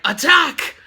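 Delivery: shouted attack order; mock battle shout; battle cry. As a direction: yell it like a charging army. X-Men charge Spider-Man.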